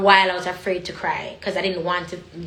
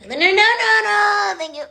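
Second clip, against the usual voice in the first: high loud run of no; emphatic refusal; 12 semitones higher, 4 dB louder than usual. Real, not acted. Speaker refusing men in her DMs, high and loud. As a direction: shut them down hard.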